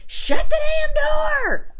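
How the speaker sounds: high yelled order, slow and drawn out; husband yelling from the shower; retold shout. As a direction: shout it at her like he's fed up in the shower. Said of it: "he shouted at me".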